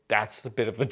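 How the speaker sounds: ordinary delivery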